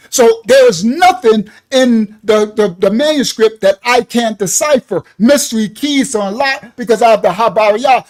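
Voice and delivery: loud sustained sermon delivery; preaching at full force. Acted. Preacher proclaiming to listeners, loud full-force sermon. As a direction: preach it hard to the congregation. Preaching about scripture tongue.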